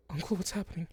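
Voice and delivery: high, scared question; fading niece asking her uncle in fright; frightened child. Acted. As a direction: ask it as a fading child losing hold. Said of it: "she looks up towards you".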